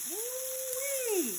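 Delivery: high, drawn-out "woo-wee"; delighted whoop. Real, not acted. Delighted at pancakes sizzling in pan.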